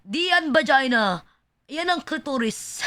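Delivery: imitating a Filipino professor correcting a student; Filipino accent. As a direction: Tagalog with a heavy Filipino accent. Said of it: "in the most Filipino accent".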